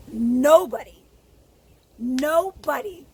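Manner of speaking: nobody hit hard and loud, the second slow; emphatic nobody. Real, not acted. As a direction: punch each nobody with force. Insists nobody should be choked.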